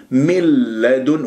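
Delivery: مل لدن with a slow held doubled لام; doubled lam. Shown as a demonstration. Teacher demonstrating assimilation in من لدنه. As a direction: merge the نون into a held لام. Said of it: "صارت الشدة على اللام".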